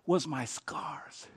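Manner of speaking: hushed, slow whisper. Real, not acted. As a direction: lean in and breathe it. Intimate moment in sermon.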